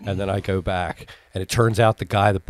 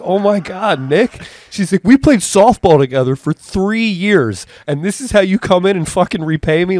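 higher and louder, betrayed and yelling; retold furious outburst; 11 semitones higher, 7 dB louder than usual. Wendy yelling at the heckler friend, loud and betrayed. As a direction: blast a friend who let you down.

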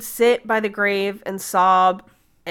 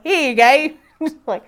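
breezy offhand quote; 4 semitones higher, 4 dB louder than usual. Retold or imitated.

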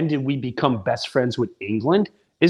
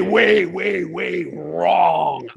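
"way" repeated long and swooping; exaggerated stress; 2 semitones higher, much slower than usual. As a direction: draw out each "way" and "wrong". Comic repeat of how wrong.

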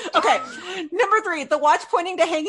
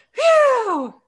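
relieved phew; 11 semitones higher, 5 dB louder than usual. Retold or imitated. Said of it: "we're just like".

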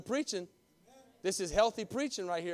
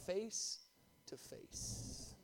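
hushed; 15 dB quieter, much slower than usual. Acted. Slowed emphatic reading.

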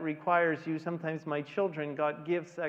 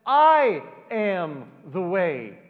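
much louder, slow, each word hammered home; proclaiming; 2 semitones higher, 11 dB louder, much slower than usual. Acted. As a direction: declare it with full force. Climax, Jesus simply says.

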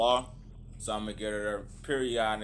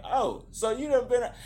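mock Caps voice; 13 semitones higher, 6 dB louder than usual. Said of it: "he was like".

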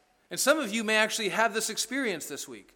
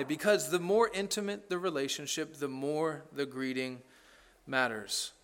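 soft emphatic close; 4 semitones lower, 5 dB quieter than usual. Acted. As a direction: let the principle sink in softly. Principle repeated, fading soft.

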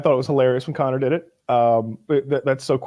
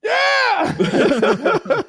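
shouted yeah. Retold or imitated. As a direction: cheer back at the fans. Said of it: "he's like".

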